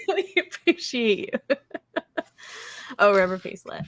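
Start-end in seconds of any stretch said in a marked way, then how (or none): 0.0-1.6 laughing. Real, not acted. Laughing thanks to viewer.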